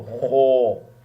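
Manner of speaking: "خو" slow, loud and full-mouthed; full-mouth kho. Shown as a demonstration. Teacher models the letter with a full mouth, slow heavy demo. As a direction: stretch "خو" out with the mouth wide open. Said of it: "منہ کو فل mouth".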